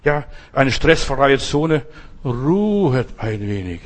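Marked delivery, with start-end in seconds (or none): 2.2-3.8 slow soothing refrain. Acted. Soothing refrain before sky imagery.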